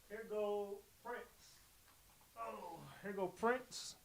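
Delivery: slow, soft sing-song reveal of a puppy; doting owner showing his puppy; drawn-out coo. Real, not acted. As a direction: stretch it out sweetly for the pup. Presenting puppy to camera.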